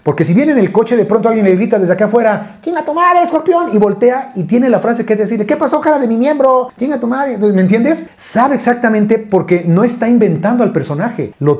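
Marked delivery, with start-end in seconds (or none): 2.6-3.7 mock street yell. Stranger yelling at the Escorpión from outside, mock yell. 5.5-7.4 cheeky comeback. Retold or imitated. Escorpión's retort from car.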